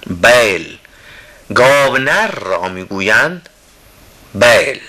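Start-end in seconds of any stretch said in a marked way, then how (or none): none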